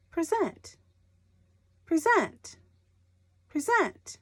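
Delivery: pri-ZENT, high and falling; stress on second syllable. Shown as a demonstration. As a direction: say present with weight on ZENT. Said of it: "If the emphasis is on the second syllable".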